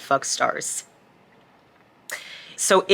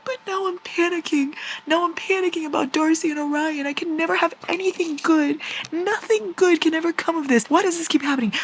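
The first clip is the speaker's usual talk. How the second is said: high panicked whine; upset reader panicking; panicky whine; 12 semitones higher than usual. Real, not acted.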